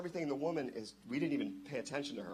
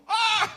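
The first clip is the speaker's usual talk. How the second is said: sudden high, loud "Ah!"; reenacted panicked shout; retold yell; 11 dB louder than usual. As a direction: holler out the window in alarm. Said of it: "then I start going".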